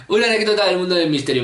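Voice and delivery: quick, matter-of-fact title read; quick repeat. Shown as a demonstration. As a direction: rattle it off the second time.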